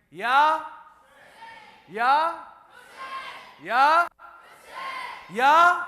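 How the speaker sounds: loud rising call to the crowd; call-out shout. Real, not acted. Preacher calling ya to the crowd. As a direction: call out for the response. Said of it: "I'm gonna say ya, and I want you to respond".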